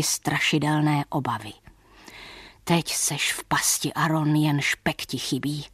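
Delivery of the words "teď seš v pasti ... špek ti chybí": sighing. Acted. Frightened peddler mutters to himself, sighing. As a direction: sigh it out to himself in dismay. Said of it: "povzdechl si".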